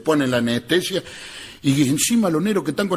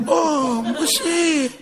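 surprised doctor; 12 semitones higher, much slower than usual. Retold or imitated. Doctor reacciona con asombro.

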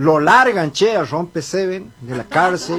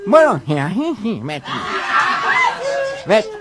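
nasal cleft-palate speech; mock nasal speech impediment; gangoso; 2 semitones higher than usual. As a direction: talk through the nose with blurred consonants. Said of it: "El otro gangoso dice".

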